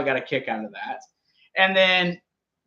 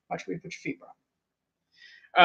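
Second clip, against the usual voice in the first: much softer, near whisper, trailing into a breathy bro; Dean Ambrose, hushed; 10 dB quieter, slower than usual. Retold or imitated. Host repeating the promo line to his co-host, almost whispered. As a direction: repeat the threat under your breath. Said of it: "the Dean Ambrose promo".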